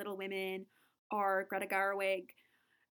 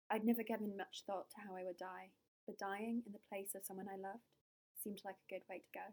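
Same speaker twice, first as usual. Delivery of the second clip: put-on soft, measured British accent; quiet British accent, like a film voice-over; Emma Watson; 2 semitones lower, 10 dB quieter than usual. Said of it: "So for British, lately, I've been thinking of Emma Watson".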